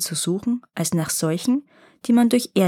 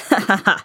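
big mocking troll laugh; 4 dB louder, faster than usual. A narrator's reading. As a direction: laugh like a scornful giant. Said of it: "lachte der Troll wieder".

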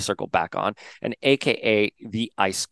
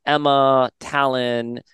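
ordinary delivery

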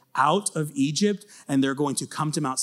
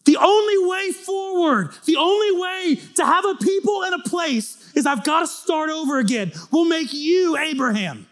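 God's stern verdict; 12 semitones higher, 3 dB louder than usual. Retold or imitated. God, shouting his judgment of the people to Moses. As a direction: thunder the judgment down. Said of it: "He said".

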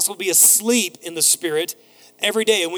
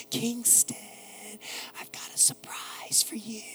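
hushed, teasing stage whisper; excited whisper; 3 semitones higher, 13 dB quieter than usual. Acted. Dad re-enacts waking his son with a surprise, whispering. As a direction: whisper a secret surprise to a small child. Dad at sleeping child's cracked door.

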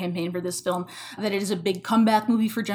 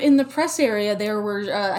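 normal talking voice

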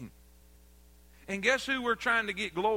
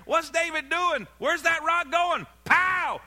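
excited shout; 6 semitones higher, 4 dB louder, slower than usual. Acted. Climax of the David story.

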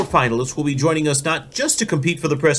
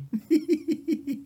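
high giggle; 12 semitones higher, 9 dB quieter than usual. Real, not acted. Laughing at own horse joke.

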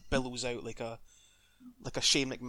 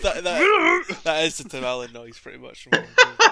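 laughing; 3 semitones higher, 13 dB louder than usual. Real, not acted. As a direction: laugh it out loudly. Laughing about Tim Allen noise.

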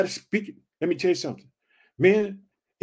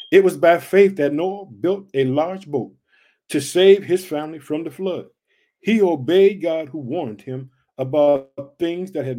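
loud, declaiming Bible reading; preacher booming out the verse; scripture read loud; 6 dB louder than usual. Real, not acted. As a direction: proclaim the verse to the congregation. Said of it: "reading scripture aloud".

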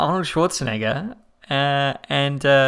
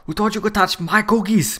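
much higher put-on movie voice; action hero impression; 7 semitones higher than usual. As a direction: play the tough guy guarding cookies. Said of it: "It's perfect, dude".

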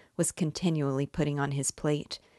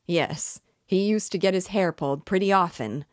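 put-on loud drunken roar, pitched higher; 4 semitones higher, 5 dB louder than usual. A narrator's reading. Drunk clerk jeers at the widow, roaring. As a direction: jeer it loudly across the room. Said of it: "roared the commissariat clerk".

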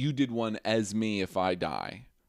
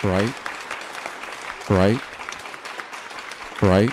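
insistent prompting; 4 dB louder, slower than usual. Real, not acted. Prompting silent partner.

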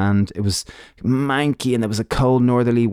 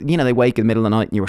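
ordinary talk, nothing marked

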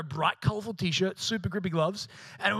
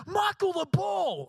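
high, slow drawn-out warning yell; retold scream; 11 semitones higher, slower than usual. Teammate screaming at the tangled goalie, high yell. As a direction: scream it across the field in alarm. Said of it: "Someone screams".

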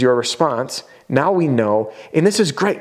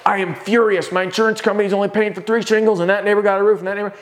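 high-pitched, indignant rant; hammed-up outrage, pitched high all through; mock furious homeowner; 7 semitones higher than usual. Homeowner complaining about a tiny insurance payout, mock furious. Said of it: "or they're super mad".

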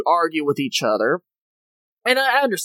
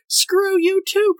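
much higher, drawn-out mock outburst; exasperated retort; 13 semitones higher, much slower than usual. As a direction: stretch it into a high, comic rebuke. Exasperated retort to retold crew member.

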